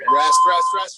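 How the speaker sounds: high, loud call of rest; shouted call. Real, not acted. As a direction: yell it across the room to stop the class. Coach calls the rest break.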